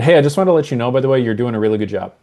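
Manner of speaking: quick throwaway praise; fast, offhand. Shown as a demonstration. Boss praises an employee fast, in passing. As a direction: rattle it off quickly. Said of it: "If I say that versus".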